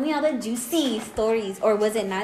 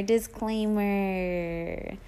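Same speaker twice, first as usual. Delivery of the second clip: drawn-out; 5 semitones lower, 7 dB quieter, much slower than usual. Acted. Final drawn-out disclaimer.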